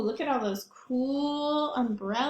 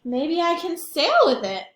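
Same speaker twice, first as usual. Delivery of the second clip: hopeful; 5 semitones higher, 7 dB louder than usual. Acted. Elephant's hopeful thought.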